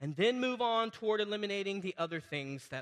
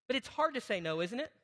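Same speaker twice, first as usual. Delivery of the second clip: ordinary delivery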